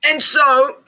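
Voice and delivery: a small fussy creature speaking up; Lorax voice. A narrator's reading. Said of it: "said the Lorax".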